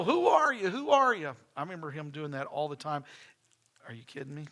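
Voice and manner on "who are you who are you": high, loud playful grandpa voice; mock-stern greeting, teasing the kids; grandfather teasing. Grandfather teasing grandkids at the back door, high playful voice. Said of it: "And he'd go".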